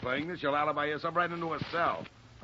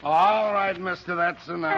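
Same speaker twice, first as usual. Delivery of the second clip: loud interruption; 5 semitones higher, 7 dB louder than usual. Acted. Boyfriend bursts in on detective.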